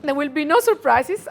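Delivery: ordinary delivery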